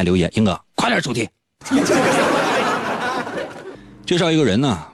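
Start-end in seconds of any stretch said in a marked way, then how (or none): 1.6-3.7 high, breathy burst of laughter; laughing. Real, not acted. Host laughing at his own teasing, real laugh. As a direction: laugh at your own joke.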